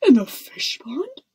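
loud, rising disbelief; horrified cry. A narrator's reading. Footman hearing of washing in the pond, crying in horror. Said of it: "cried the footman in horror".

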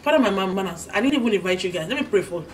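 normal talking voice

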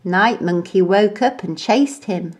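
ordinary delivery, nothing marked

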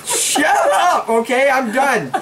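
high frightened shout; yelling in fright. Real, not acted. Friend frightened by the game.

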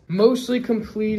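ordinary talk, nothing marked

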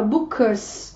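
"bookers" as book plus a voiceless s; final S sound. Shown as a demonstration. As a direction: end "bookers" on a voiceless S after the K. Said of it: "chúng ta cũng đọc là". ("bookers" đọc đuôi là âm s)

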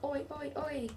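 high Aussie oi chant; Aussie accent. Shown as a demonstration. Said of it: "our best Aussie accents".

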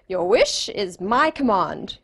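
slow, drawn-out genie decree. Retold or imitated. Genie answering a thirsty man, slow and stately. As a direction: stretch it out as a genie granting a wish. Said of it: "So he says".

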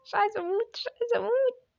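high panicky plea ending in a yelp; rising, frightened whine; scared of dying. Real, not acted. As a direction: plead in a high voice, then cry out. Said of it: "انا خايف جدا اموت".

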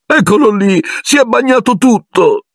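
a self-pitying ghost telling her grievance. Acted. Ghost girl explaining to Harry how the book fell, high sulky whine.

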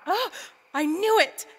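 loud triumphant exclaim. Acted. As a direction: cry out in triumph. Finds the secret door.

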